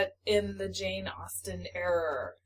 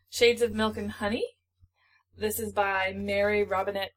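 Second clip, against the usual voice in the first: usual speaking voice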